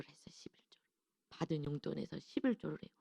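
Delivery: hushed secret-sharing whisper; awed whisper. Real, not acted. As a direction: whisper it, full of wonder. Awed aside about the tithe. (감탄하며 속삭이듯)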